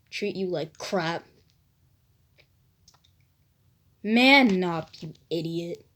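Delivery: a scornful whisper from inside his skull; voice in his head. Acted. A voice in Naruto's head goads him, low sneer. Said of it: "a voice inside of his head says".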